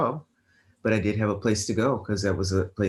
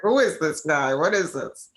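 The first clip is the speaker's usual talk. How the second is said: high, louder mock bewilderment; amused bafflement; 6 semitones higher, 4 dB louder than usual. Woman recalling an unexpected call, amused bafflement. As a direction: retell your bafflement, pitched right up. Retells puzzlement at surprise call.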